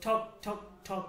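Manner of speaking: three separate ঠক knocks, spaced out slowly; hammer knocking sound. Shown as a demonstration. Teacher imitating a hammer on a nail, slow spaced knocks. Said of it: "তখন একটা আওয়াজ হয়".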